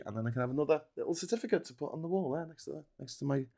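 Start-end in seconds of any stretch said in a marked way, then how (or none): none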